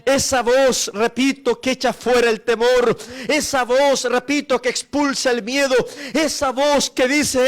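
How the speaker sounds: rising sermon crescendo; fervent preaching. Acted. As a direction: preach it out to the congregation. Preacher at full force again.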